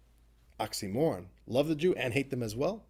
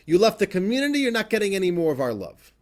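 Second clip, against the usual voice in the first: loud, higher, indignant shout; community's angry rejection; 6 semitones higher, 8 dB louder than usual. Retold or imitated. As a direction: throw him out, raising your voice. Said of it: "That was kind of the attitude".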